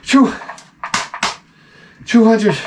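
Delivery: high, stunned stammer; stammering shock. Retold or imitated. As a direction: stutter it out in awe.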